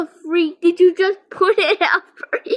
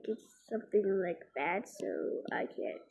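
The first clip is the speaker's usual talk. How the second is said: fast, breathless whisper; 11 semitones lower, 20 dB quieter, much faster than usual. Real, not acted. Telling viewers she can't say more, hurried whisper.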